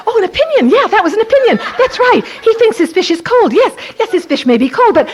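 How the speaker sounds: high, loud sing-song mockery; mock his complaint in a high teasing voice; high loud teasing self-talk with laughs; mocking inner voice.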